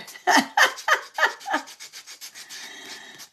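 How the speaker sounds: high unvoiced burst of laughter; long high cackle. Real, not acted. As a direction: crack up at the word. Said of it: "big laugh".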